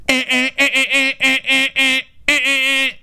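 high nasal horn beeps; imitated honking. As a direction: mimic a car horn blaring over and over. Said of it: "My friend in that situation would be like".

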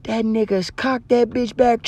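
usual speaking voice

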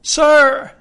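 high, loud cry of protest; pained cry. A narrator's reading. Boy protests as the blind man wrenches his arm, crying out. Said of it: "made me cry out".

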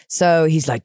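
ordinary talk, nothing marked

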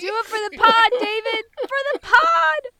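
loud, high rallying plea, last words stretched; mock pleading. Begging co-host David to go along, mock-dramatic plea.

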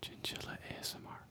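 hushed, unvoiced whisper; mock ASMR. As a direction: whisper it softly like an ASMR video. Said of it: "I'm about to do a perfect one".

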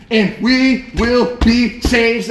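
slow, loud verse climax; emphatic pulpit cadence; preaching scripture. Acted. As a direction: land each word hard and drawn out.

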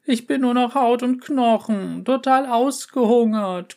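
dramatic starving wail. Acted. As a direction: howl with hunger. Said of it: "heulte Miauzka".

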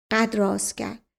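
usual speaking voice

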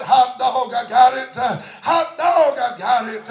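loud exclamation of joy; mother shouts hot dog, overjoyed; joyful shout. Retold or imitated. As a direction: rejoice out loud, just saved. Mother leaps up rejoicing.

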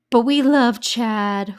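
slow, loud and stretched, leaning hard on love; but we love Chad, swooning over him; gushing. Real, not acted. To her listeners about Chad, a drawn-out loud gush.

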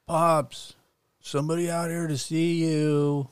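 loud call to the back room. Acted. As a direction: shout it over your shoulder. Jeff calls to the back room.

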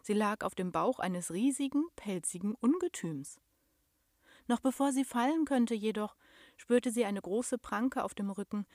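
usual speaking voice

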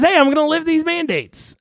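high mock announcement; a sarcastic squeaky governor; mocking Beshear voice. Host mimics the governor's offer to lift mandates, high mock. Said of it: "I'm doing this voice for Beshear".

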